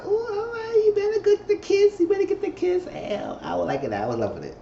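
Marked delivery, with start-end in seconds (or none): 0.0-2.8 very high, squealy cheering voice; squealing excitement. Retold or imitated. Viewer egging on the kiss, high squealing cheer. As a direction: squeal like a fan watching the kiss. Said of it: "I said".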